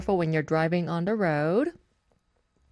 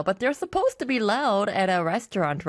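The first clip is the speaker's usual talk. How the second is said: ordinary talk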